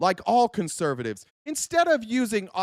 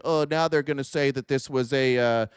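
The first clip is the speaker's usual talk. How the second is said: low sluggish sneer at Rogan's false-flag line; mocking Joe Rogan; 7 semitones lower than usual. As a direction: drop the voice low and play dumb. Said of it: "His whole takeaway from that was".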